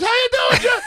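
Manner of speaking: very high-pitched squeaky mock voice; Jared Kushner. Caller from Air Force One, in a mocking high-pitched voice. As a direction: mimic him way up high in pitch. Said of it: "he has the high-pitched voice".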